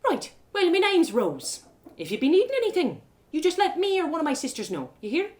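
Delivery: a friendly tavern barmaid welcoming a stranger; cheerful barmaid. Acted. Barmaid Rose greets the traveler.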